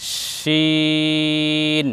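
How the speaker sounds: শিন held very long and slow; drawn-out letter. Shown as a demonstration. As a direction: stretch শিন out for pupils to copy. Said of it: "আমার সঙ্গে বলতে থাকুন". (ধীরে টেনে শিন উচ্চারণ)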